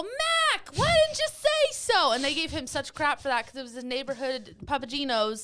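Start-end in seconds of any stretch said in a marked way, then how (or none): 0.0-2.1 very high, loud Boston-style outburst; mock exasperated relief. Pizza staff finally getting dad's name, hammed-up exclaiming. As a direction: squawk it as if it's suddenly obvious. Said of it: "And they go".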